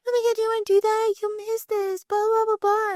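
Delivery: high-pitched imitation of pestering friends; mock nagging friends. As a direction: go way up high, parodying pushy pals. Mimics people pressuring her to stay out.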